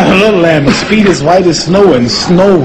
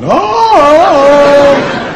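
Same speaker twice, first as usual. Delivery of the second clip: long high jungle yell; Tarzan holler; 11 semitones higher, 4 dB louder than usual. Retold or imitated. Man swinging around Africa in a diaper, hollering like Tarzan.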